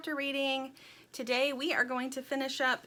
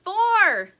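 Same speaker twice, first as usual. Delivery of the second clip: high, very loud count; yelling; 10 semitones higher, 13 dB louder than usual. A narrator's reading. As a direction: holler your number as you plunge. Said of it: "yelled Dirt".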